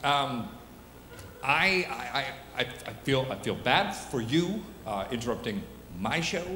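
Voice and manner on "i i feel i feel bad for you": ordinary talk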